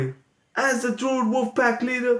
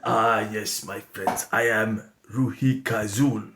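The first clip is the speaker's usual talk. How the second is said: put-on much lower, softer old sensei voice; very low quiet Grandmaster sensei; Grandmaster Ruhi Kazul; 9 semitones lower, 4 dB quieter than usual. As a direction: speak low and hushed like a wise old master. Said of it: "I want to introduce the Grandmaster Sensei".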